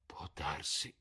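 soft, sighed concession; sighing. A narrator's reading. Lord Henry half concedes to Dorian, softly sighing. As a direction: concede it on a gentle sigh. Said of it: "sospirò Lord Henry".

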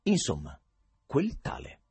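plain reading voice

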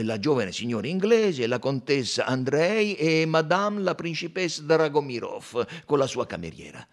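loud, dutiful counting voice; conductor counting. A narrator's reading. Conductor to Poirot, counting the women aloud. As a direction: count off the passengers for the detective.

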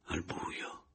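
soft whispered confession. A narrator's reading. The narrator confesses a kiss in the dark.

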